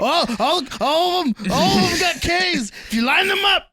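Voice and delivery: high, loud, hammed-up shouting; frantic comic shout, stretched words; mock conspiracy rant. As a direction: yell it like a crazed revelation. Ranting comic conspiracy about K names.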